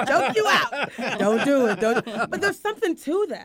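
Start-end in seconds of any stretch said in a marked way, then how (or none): 0.0-2.0 slow, laughing words. Real, not acted. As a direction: laugh through it. Laughing at the choking joke.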